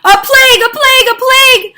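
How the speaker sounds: high, loud wailing cry of doom; dramatic cry. Acted. Storyteller crying out the plague refrain dramatically. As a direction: cry out the plague like a warning bell.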